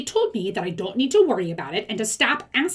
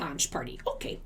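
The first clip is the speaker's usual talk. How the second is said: whispered aside; 10 dB quieter than usual. Real, not acted. Whispered aside while reading.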